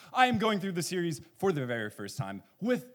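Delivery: breathless after the big opening; out of breath. Real, not acted. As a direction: talk while catching your breath. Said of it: "I'm a lot of breath".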